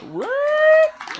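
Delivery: high stretched what; astonished. Acted. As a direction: squeal in disbelief. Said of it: "mock astonishment".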